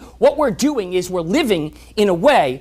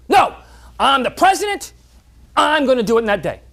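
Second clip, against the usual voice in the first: defiant president; 6 semitones higher, 5 dB louder than usual. Acted. President insists again.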